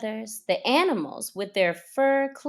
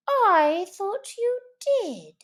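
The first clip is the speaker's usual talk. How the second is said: mild suspicious Mouse; 10 semitones higher than usual. Acted. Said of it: "said the Mouse".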